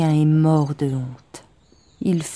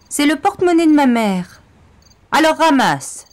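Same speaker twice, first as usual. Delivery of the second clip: harsh taunt; 9 semitones higher, 6 dB louder than usual. Acted. Gang leader taunts, loud.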